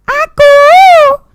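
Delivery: very high, long-held GO; high sing-out to the audience; high-pitched call. Shown as a demonstration. Varied call for audience response.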